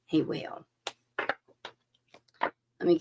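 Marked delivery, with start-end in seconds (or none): 0.1-0.6 a soft secret assurance; whispered. Real, not acted. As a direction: breathe it out in a whisper. Whispered again.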